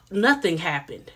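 lying flatly. Retold or imitated. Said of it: "lock eyes with Alexis and lie".

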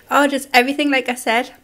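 normal talking voice

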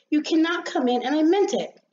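high, indignant little rosebud; firm refusal. Acted. Rosebud refuses the sun at the door, firmly.

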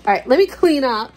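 normal talking voice

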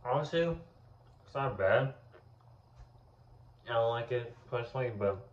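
talking between bites; chewing while talking. Real, not acted. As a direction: mouth partly full. Seen 0: Bites at -2, lips pressed chewing through early words, treat in hand.

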